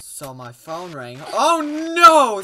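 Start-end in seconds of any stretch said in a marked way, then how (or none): 1.3-2.4 loud dismayed cry; shouting. Real, not acted. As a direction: yell oh no. Phone rang, dismay.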